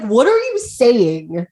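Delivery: slow, drawn-out baffled question; mock confusion. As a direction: play a bewildered listener. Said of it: "people would just be confused".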